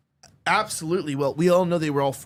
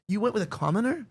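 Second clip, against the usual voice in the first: mock scandalized; 6 semitones higher than usual. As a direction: imitate an appalled aristocratic relative, pitched up. Voicing scandalized relatives of runaway duchess.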